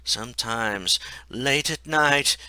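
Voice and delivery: ordinary delivery, nothing marked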